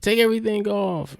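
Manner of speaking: high, panicky wail broken by laughter; mock freak-out. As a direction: act out robbery panic, cracking up. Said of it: "and they're freaking out".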